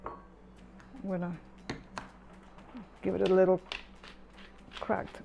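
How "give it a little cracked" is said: usual speaking voice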